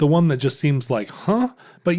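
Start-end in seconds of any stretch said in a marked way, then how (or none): 1.1-1.5 a high, puzzled "Huh?"; baffled huh. Pretend. A mock baffled reader reacting to an odd story. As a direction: play it like a confused reader.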